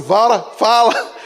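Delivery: loud mock-demanding mother; mãe brava. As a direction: imitate a mother loudly demanding an answer. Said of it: "Aí ela chegava e falava assim". (imitando mãe cobrando em voz alta)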